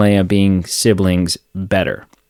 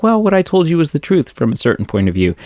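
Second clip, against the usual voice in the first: quick, higher mock-wise old man voice; smug Obi-Wan; 6 semitones higher, faster than usual. Friend retelling Star Wars, mocking Obi-Wan's quick smug excuse. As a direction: rattle off the excuse like a sly old mentor. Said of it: "And he's like".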